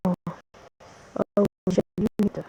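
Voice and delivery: ordinary delivery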